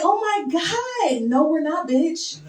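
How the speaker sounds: high, drawn-out gush turning into a put-down; mock excitement. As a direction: parody the thrilled squeal, then shoot it down. Mimics coworker's gush, then shuts it down.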